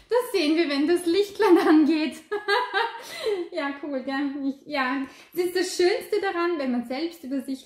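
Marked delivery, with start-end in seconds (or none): 2.3-3.5 self-mocking laugh. Real, not acted. Reader laughing at her own pronunciation, amused. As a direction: laugh off the stumble.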